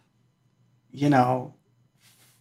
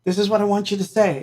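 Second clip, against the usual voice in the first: higher, fast, pushy insistence; raised eager insisting; 6 semitones higher, faster than usual. Retold or imitated. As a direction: chase him down with it, raised and quick. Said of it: "And I was like".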